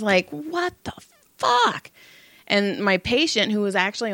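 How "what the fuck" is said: high, rising disbelief; incredulous outburst. Retold or imitated. Massage therapist reacts to banging and ringing, incredulous retold outburst.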